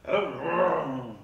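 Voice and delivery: loud imitated engine roar swallowing "Hello"; mimicked passing bus over the greeting; hello drowned by bus. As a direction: say "Hello" and bury it under a vroom. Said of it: "all you heard was".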